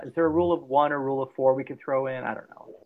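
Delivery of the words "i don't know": breathy unvoiced aside; whispered aside. Real, not acted. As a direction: drop to a whisper. Trailing off uncertain.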